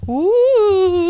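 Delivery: long high, loud ghostly "ooh"; haunting ghost voice. Pretend. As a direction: wail it like a cartoon ghost. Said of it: "That's my haunting voice".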